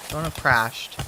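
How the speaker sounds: ordinary talk, nothing marked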